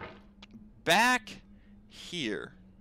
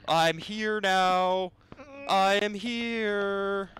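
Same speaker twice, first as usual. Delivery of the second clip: zen mantra; 4 dB louder, much slower than usual. Shown as a demonstration. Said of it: "we gotta be zen".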